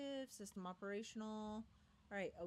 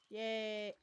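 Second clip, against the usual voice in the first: louder, ironic little cheer; sarcastic yay; 7 dB louder than usual. Real, not acted. Player heading into a graveyard, mock-cheerful yay. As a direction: fake delight at something spooky.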